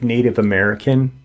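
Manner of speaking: plain reading voice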